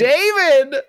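high, loud game-show call; excited shout. Real, not acted. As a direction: call the name out in triumph. Host shouts correct answerer.

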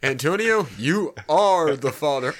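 talk show host announcing. Retold or imitated. Maury Povich style announcement.

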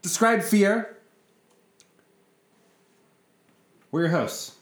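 show intro announce. Acted. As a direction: announce the show's name like a host.

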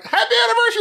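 a very high, bright shout of happy anniversary; mock surprise party cheer. Surprise party guests greeting the couple, hammed-up cheer. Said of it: "everybody's like".

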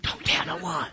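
breathy whispered "Shh", hushed secret. Retold or imitated. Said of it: "heal somebody ... and say".

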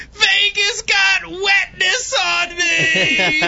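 put-on high, slow, wailing child's shout; whiny little girl. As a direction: wail it like an upset toddler. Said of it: "get her to stop getting over the wetness thing".

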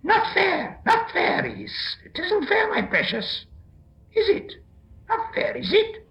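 very high, squealing creature whine; upset Gollum. A narrator's reading. Gollum complaining the riddle is not fair, upset whine. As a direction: protest in a high, hissing screech. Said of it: "he was frightfully upset".

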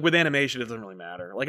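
normal talking voice

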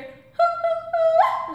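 high, loud hooting; son's hooting call. Retold or imitated. Son Lyndon calling back, high hoots. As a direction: hoot high to carry far. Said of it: "And then I would hear".

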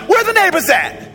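incredulous. Retold or imitated. Arriving at remote country house.

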